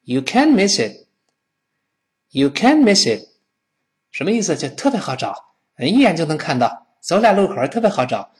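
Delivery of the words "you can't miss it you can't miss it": slow, with "can't" punched high; model sentence. Shown as a demonstration. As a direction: lean hard on "can't", falling after it. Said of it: "经常加这么一句叫".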